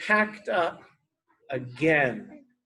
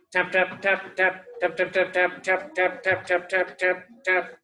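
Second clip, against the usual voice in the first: even, monotone rapid tapping; tapping noise. Acted. A noise disturbs napping Tortoise, steady monotone tapping. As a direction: tap out each word like a steady knock.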